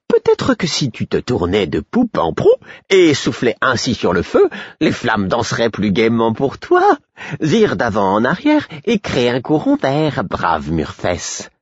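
high, playful jester lilt; mock-helpful advice, dragging out the last words; teasing fool. Fool teasing Murfess on all fours, playful.

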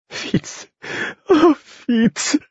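breathless whimper rising to a high cry; tearful, overwhelmed relief; sobbing. A narrator's reading. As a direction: cry his name through tears. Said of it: "fit-elle en sanglotant".

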